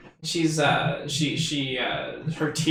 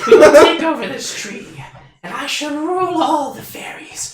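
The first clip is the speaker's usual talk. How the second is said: high, loud, cackling little fairy; evil laugh; 11 semitones higher, 7 dB louder than usual. Acted. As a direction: laugh wickedly while plotting. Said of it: "Look at that little evil laugh".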